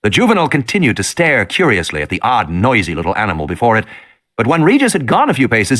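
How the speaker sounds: ordinary delivery, nothing marked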